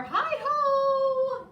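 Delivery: loud high cheerful call; high ringing Hi-ho. Acted. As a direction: hail them from the doorway. Hare calls out from door.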